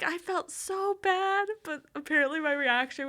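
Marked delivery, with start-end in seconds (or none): 0.0-1.5 mock guilty mom. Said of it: "she's like".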